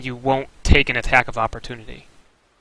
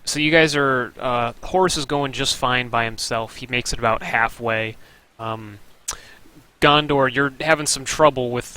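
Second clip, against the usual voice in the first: usual speaking voice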